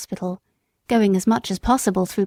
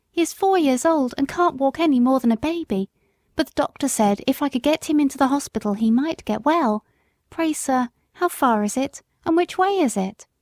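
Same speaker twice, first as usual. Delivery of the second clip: lightly raised pleading mother's voice; a poor mother begging help, gently higher; young country mother; 5 semitones higher than usual. Acted.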